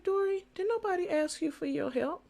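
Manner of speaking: inner voice. Retold or imitated. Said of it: "I said to myself".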